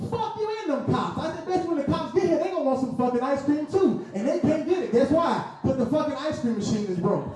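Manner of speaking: high, heated rant at the window; irate customer. Retold or imitated. Customer ranting at the McDonald's worker, high mock fury. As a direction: yell it out, furious over ice cream. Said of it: "I'm getting irate".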